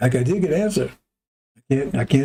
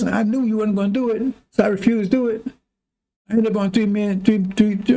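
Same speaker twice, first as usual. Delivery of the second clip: high, raised voice, complaining to God; mock argument with God; 7 semitones higher than usual. Preacher retelling his argument with God, raised voice. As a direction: protest like a wronged prophet, pitched up. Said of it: "He got to argue with the God".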